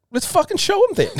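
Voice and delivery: high, exasperated demand. Retold or imitated. Urging the team member to show Snoop, high exasperated. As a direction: push him to act, voice up.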